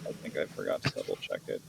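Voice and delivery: whispered confession. Real, not acted. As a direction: admit it under your breath. Whispered admission.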